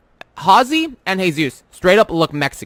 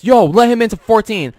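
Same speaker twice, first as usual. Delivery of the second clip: mild mock yell, raised pitch; retold yell; 5 semitones higher, 4 dB louder than usual. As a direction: call it out like an imitated order. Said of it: "yelling at us".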